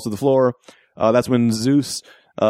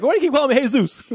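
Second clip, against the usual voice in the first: fast, much higher annoyed comeback; annoyed protest; 13 semitones higher, faster than usual. Retold or imitated. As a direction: snap back at the wrong name. Zeus protests the name.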